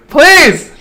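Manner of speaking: sudden high desperate shout; shouted plea. Real, not acted. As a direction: beg out loud at the screen. Begging loudly during game upgrade.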